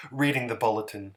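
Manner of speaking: ordinary delivery, nothing marked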